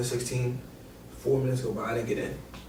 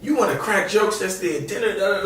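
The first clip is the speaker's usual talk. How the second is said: higher, louder mock scolding; coach scolding; 8 semitones higher, 4 dB louder than usual. Coach in locker room scolding Cash, louder mock rant. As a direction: call him out for his jokes. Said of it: "and he goes".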